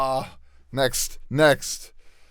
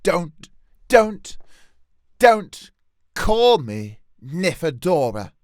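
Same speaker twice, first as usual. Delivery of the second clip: each don't louder; building insistence; 3 semitones higher, 6 dB louder than usual. Shown as a demonstration. Fed up with her name, louder don'ts. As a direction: hammer the don'ts harder each time. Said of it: "It's gonna have to be that".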